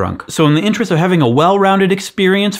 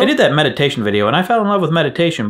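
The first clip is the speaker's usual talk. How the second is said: usual speaking voice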